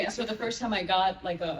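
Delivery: normal talking voice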